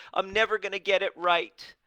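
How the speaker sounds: high, loud whiny wail of defeat; a hammed-up moan that nothing works; mock self-pity. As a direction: ham up the poor-me voice. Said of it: "And you have this".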